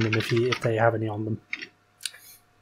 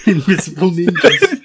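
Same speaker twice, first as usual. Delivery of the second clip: laughing through the words; can barely talk for laughing; 5 semitones higher, 6 dB louder than usual. Real, not acted. Laughing while speaking.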